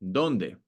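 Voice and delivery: "Donde" stressed on DON, pitch dropping after. Shown as a demonstration. Teacher repeating the example for learners, "Donde" stressed on the O. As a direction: lean on the first syllable of "Donde". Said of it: "On the O".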